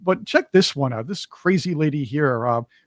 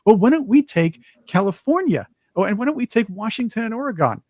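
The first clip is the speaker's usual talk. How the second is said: higher-pitched mock smugness; mock clever; 6 semitones higher, 4 dB louder than usual. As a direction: imitate an official who thinks she's being clever. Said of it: "trying to be cool and clever".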